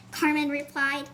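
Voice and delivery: usual speaking voice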